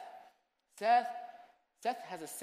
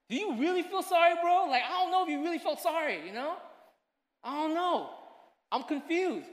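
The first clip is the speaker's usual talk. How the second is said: high, louder puzzled questioning; baffled dad; 10 semitones higher, 7 dB louder than usual. Retold or imitated. Father questioning his son's sorry, high and puzzled. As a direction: ask like a dad who doubts the apology.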